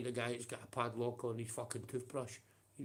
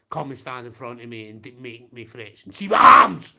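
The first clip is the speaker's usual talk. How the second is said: yelling in real rage, much louder at the end; furious shout; 24 dB louder than usual. Real, not acted. Streamer yelling a real challenge at his online rival, furious shout. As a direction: roar the challenge straight at him. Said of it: "Sorry for getting a bit emotional".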